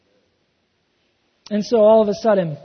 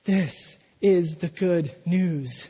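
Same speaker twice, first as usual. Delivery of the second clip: slow, weighty delivery of "this is the good news"; slow emphasis; 3 semitones lower, 3 dB quieter, much slower than usual. Shown as a demonstration. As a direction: let "this is the good news" land slowly. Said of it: "but the truth is".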